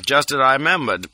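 ordinary delivery

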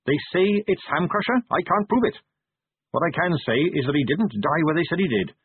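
put-on earnest young captain. Character dialogue in audiobook.

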